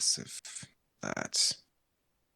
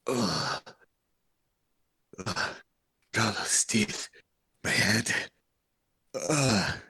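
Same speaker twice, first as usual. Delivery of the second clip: groaning through a bag; muffled, hurting groans; muffled inside a sack; slower than usual. Acted. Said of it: "you hear muffled from inside the bag".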